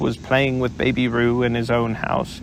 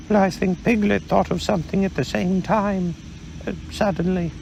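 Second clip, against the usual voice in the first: Pooh, a little vague; mild bear voice, unhurried; lightly voiced Pooh bear; 7 semitones higher than usual. Pooh speaks to Kanga.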